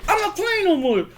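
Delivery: high comic warning; mock angry outburst. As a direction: snap in a very high movie-imitation voice. Acted outburst in nunchuck fight.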